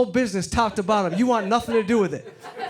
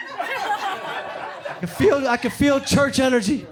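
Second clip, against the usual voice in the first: mock mystic; 5 semitones higher, slower than usual. Mock psychic pitch rise.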